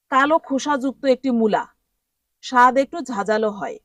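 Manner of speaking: ordinary talk, nothing marked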